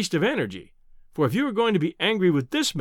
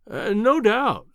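humoring; 6 semitones higher, 4 dB louder, much slower than usual. A narrator's reading. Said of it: "as one humors an imbecile".

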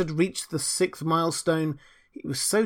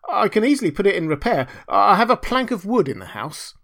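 higher, louder, eager simple fellow; delighted little Hans; 7 semitones higher, 5 dB louder than usual. Acted. Hans happily planning the repair, delighted. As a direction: offer it brightly and eagerly.

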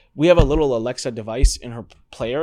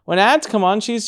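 ordinary talk

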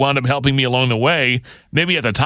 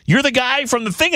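higher-pitched retold astonishment; mock surprise; 12 semitones higher than usual. Host retells meeting the guy from Carbondale, surprised. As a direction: act out delighted recognition, pitch jumping up.

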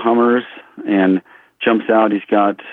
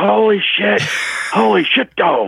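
high-pitched amazed outburst, imitated; Chris Farley; 13 semitones higher, slower than usual. Onlookers reacting to amazing shooting, crazed astonishment. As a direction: go crazy with astonishment, voice shot way up. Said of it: "It's like Chris Farley, he's going crazy".

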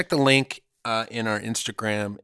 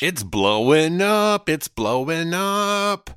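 slow, higher sing-song brag; drawn-out hype; 8 semitones higher, 7 dB louder, much slower than usual. Shown as a demonstration. Cohost echoing the brag, slow high sing-song. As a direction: stretch out blowing up smugly. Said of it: "like that".

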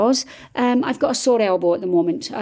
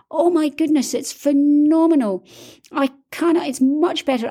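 high, thrilled praise; excited; 6 semitones higher than usual. Real, not acted. Writer telling viewers the dictate feature is phenomenal, thrilled. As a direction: gush like it changed your life.

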